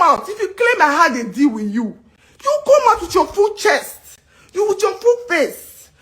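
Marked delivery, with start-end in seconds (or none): 2.4-5.9 high, crying demand; tearful outrage; near tears. Real, not acted. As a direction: push through tears demanding. Upset, demanding proof.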